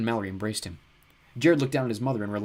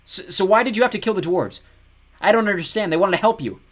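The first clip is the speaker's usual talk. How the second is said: high, louder voice; raised voice; 10 semitones higher, 10 dB louder than usual. Acted. Jared calls out to the ogre to keep him talking, louder. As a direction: speak up to hold the ogre's attention. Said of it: "then raised his voice".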